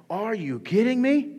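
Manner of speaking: high, slow, drawn-out amazement; acted disbelief. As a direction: imitate a crowd stunned in disbelief. Imitating amazed Israelites at parted sea.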